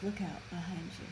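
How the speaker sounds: very soft, understated mock warning; imitated whisper. Woman back alive warns swamp man, feeble imitated whisper. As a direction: whisper it faintly, with no urgency. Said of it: "she basically whispers".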